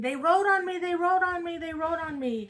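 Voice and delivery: high, urgent repeated cry, acted out; retold panicked yelling. Mother acting out her son running up in a panic, yelling. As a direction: imitate frantic yelling, high and loud, easing off at the end. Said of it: "screaming and yelling".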